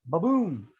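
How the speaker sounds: ordinary talk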